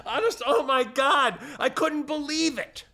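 very high, exasperated outburst; incredulous. Real, not acted. Host reliving the checkout fiasco, incredulous. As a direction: voice shoots up in sheer disbelief. Worked-up disbelief at end of story.

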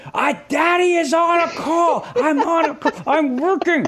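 high, strained mock shout, drawn out; exasperated dad yell. Dad calling to his screaming kids during a work call, exasperated yell. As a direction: act out a father hollering at noisy kids. Retold yelling at kids behind door.

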